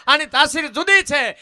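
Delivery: high, very loud folk-poet declamation; જુસ્સાદાર કાવ્યપઠન. Acted. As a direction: proclaim the verse to the crowd at full force. Declaims verse at full force. (જોશભેર, ઊંચા અવાજે લલકાર)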